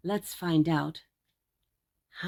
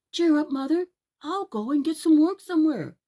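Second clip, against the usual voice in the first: put-on higher, louder boyish voice; cheerful boy Jack; 8 semitones higher, 7 dB louder than usual. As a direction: brightly reassure your mother. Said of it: "said Jack".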